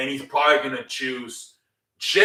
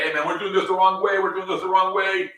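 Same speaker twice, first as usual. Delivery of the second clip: higher-pitched, hurried mock plea; urgent intervention; 7 semitones higher, faster than usual. Jay steps into the fight to stop them, urgent pleading. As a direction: cut in quickly with a high, insistent protest.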